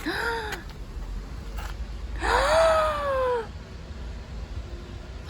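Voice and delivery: sharp high gasp of shock; startled gasp. Real, not acted. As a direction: gasp at what you just saw. Sees remains with skin and hair.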